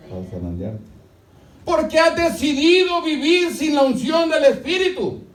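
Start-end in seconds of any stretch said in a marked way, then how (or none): 1.6-5.2 full-force preaching. Acted. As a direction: drive God's words home at full voice. Preaching shout.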